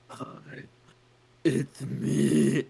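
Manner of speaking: hammed-up awkward guy, breathy hi into loud it's me; cringey creator greeting. Imagining a dreaded co-host's videos, cringey mock greeting. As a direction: put on a big cheesy hello. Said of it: "some guy being like".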